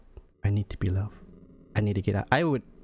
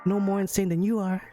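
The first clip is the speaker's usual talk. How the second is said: higher, taunting voice for the date; a higher, scornful game villain; mocking cruel date; 6 semitones higher than usual. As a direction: sneer down at the lonely loser. Reads date's mocking reply.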